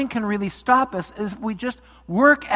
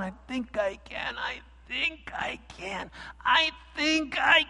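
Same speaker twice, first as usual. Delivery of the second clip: slow, rising higher with strain; slowing effort; 6 semitones higher, 5 dB quieter, slower than usual. Acted. Little engine reaching the summit, slow and rising. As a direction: push each word slower toward the top. Engine nearing the steep top.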